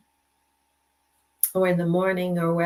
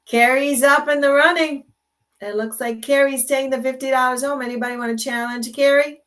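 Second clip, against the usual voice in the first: higher and louder, hyping the leader; excited announcing; 5 semitones higher, 4 dB louder than usual. Real, not acted. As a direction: call it out like a game host. Announcing contest leader live.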